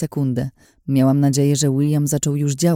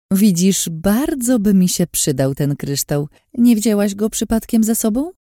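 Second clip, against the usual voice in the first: higher, smug, teasing voice; sly Lin, taunting; 7 semitones higher than usual. A narrator's reading. Lin teasing Anna she has seen through her disguise, sly and smug. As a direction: purr it out like a scheming woman. Lin's voice in narration.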